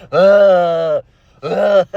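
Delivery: loud, drawn-out exaggerated whimpering groan; mock pathetic groan. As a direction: groan like a begging simp, hammed up. Pathetic simp act ends in groans.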